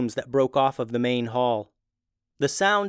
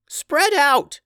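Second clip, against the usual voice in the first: high, loud commanding yell; 6 dB louder than usual. Acted. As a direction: shout the order to the group. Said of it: "yelled Tubes".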